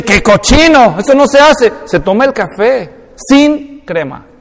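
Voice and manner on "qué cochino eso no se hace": louder, higher indignant objection; mock scolding. Coffee purist objecting to dunked biscuits, mock indignant. Acting out disgusted objection to dunking.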